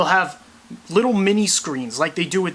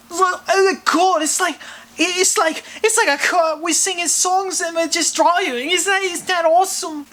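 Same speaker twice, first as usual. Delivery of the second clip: much higher, giddy Corden voice; Corden impression; 11 semitones higher than usual. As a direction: gush like Corden in the car. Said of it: "he's doing, like, carpool karaoke".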